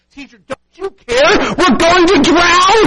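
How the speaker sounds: high, loud, slowed panicked plea; panicked cry. Acted. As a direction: cry out as if the boat is sinking. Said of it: "woke him and said".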